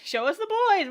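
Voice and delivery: high, swooping playful cry; mock demand. Echoing co-host's call for the boys, mock demand. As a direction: echo a fan's cheer, pitched well up.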